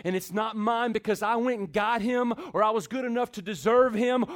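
usual speaking voice

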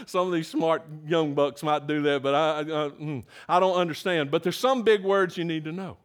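ordinary talk

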